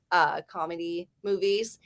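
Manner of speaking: usual speaking voice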